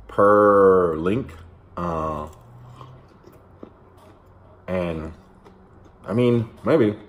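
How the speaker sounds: talking while eating sausage; speaking between bites; mouth full, chewing. Real, not acted. Seen 1: Bites sausage at 1.5-2.8, then talks while chewing it.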